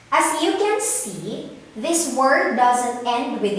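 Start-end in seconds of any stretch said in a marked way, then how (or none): none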